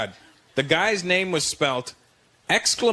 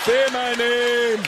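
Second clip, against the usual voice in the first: high, drawn-out climactic cry; mock-passionate wail, very slow; dealer making love; 6 semitones higher, 3 dB louder, much slower than usual. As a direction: build to a hammed-up high demand. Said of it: "Imagine he's in there with his woman".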